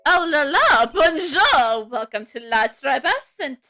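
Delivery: effusive mock-French restaurant host; butchered French accent. Restaurant host welcoming customers, butchered French accent. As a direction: greet grandly in a bad French accent. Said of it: "I can't do a French accent".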